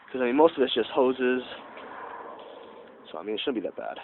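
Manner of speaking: stuffy nasal voice from a cold. Real, not acted. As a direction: talk through a blocked nose. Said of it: "sorry for my nasal, nasally voice. I got a cold".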